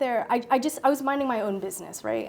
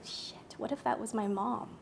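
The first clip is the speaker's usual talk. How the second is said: hushed dismay; 8 dB quieter than usual. Retold or imitated. Sudden guilty realization on trail.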